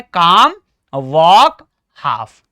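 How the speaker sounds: काम, वॉक, हाफ each with silent l; spaced model words काम, वॉक, हाफ, no l in any; right pronunciation. Shown as a demonstration. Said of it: "right pronunciation क्या है". (काम, वॉक, हाफ, 'ल' चुप रखकर)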